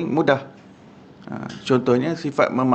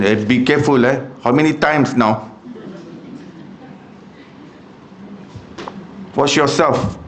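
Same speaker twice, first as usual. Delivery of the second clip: angry impatience; 2 semitones higher, slower than usual. Retold or imitated. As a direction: bark it out like a fed-up man. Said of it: "tu dia marah sangat tu".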